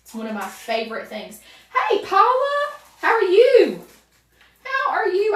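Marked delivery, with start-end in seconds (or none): 1.9-5.4 much higher and louder gushing hello; excited greeting. Real, not acted. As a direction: call out to her with delight. Greeting a viewer in chat.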